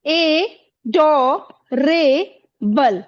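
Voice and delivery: "ए, डो, रे, बल" as four separate beats; syllable by syllable. Shown as a demonstration. Teacher shows pupils how the word divides, "ए, डो, रे, बल" syllable by syllable. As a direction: break it with pauses: "ए", "डो", "रे", "बल". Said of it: "इसको हम कैसे बोलते हैं".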